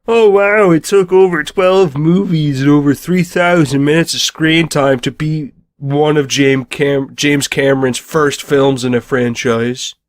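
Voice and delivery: loud, high sarcastic whine, slowing at the end; mocking sarcastic. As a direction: sneer it like a sore loser. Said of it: "people that aren't very happy ... are like".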